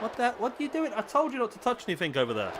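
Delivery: high-pitched real telling-off; scolding. Real, not acted. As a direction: break off to scold someone nearby. Scolding someone off-mic for touching things.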